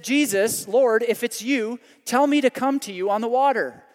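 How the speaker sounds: loud, high, bold challenge from Peter; brash eager call. Acted. Peter shouting to Jesus across the stormy water, loud and brash. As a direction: call out boldly, a bit reckless. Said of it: "He said something really stupid".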